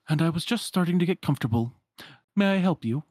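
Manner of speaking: a touch of huffy politeness; put-out wardrobe. Acted. Roused wardrobe addressing an intruder, mildly put out. Game's wardrobe figure, woken up.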